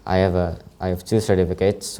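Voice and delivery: ordinary talk, nothing marked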